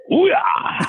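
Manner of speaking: a high, loud, hammed-up Pacino whoop. Imitating the star's whoop of delight at the food. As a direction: belt it out like Pacino tasting the dish. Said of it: "and he goes".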